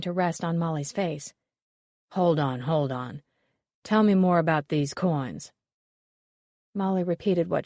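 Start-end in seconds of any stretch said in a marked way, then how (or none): 2.1-5.5 lightly voiced detective. A narrator's reading. Detective interrupts.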